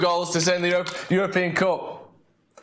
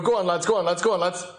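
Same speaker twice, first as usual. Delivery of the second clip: ordinary talk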